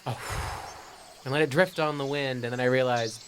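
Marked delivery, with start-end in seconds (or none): 0.2-0.9 one long breath blown out; blown puff. Retold or imitated. Retelling blowing on a dandelion, breathy puff. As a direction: blow out like scattering dandelion seeds.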